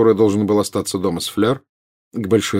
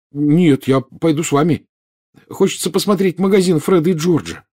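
put-on quick-to-object boy, pitched up; hasty reply; 8 semitones higher, 3 dB louder than usual. A narrator's reading. Boy begs off staying home, hastily objecting. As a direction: backtrack eagerly before mum decides. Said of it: "поспешно отозвался Рон".

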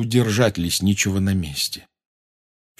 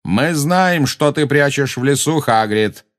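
raised-pitch shout, moderately loud; calling after; 6 semitones higher, 3 dB louder, slower than usual. A narrator's reading. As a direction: call out a warning to someone walking off. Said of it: "крикнул им вслед Магориан". (крикнуть вслед)

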